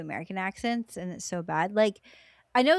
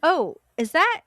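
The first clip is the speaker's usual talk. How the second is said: high, louder mock-naive wondering; hammed-up innocent question, pitch climbing; clueless Dax; 10 semitones higher, 6 dB louder than usual. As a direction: play someone sheltered and oblivious. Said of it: "kinda stupid".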